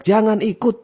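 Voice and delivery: loud, high-pitched warning yell; sharp shout. A narrator's reading. Mother orders child not to follow, shouting. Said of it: "ibuku malah teriak".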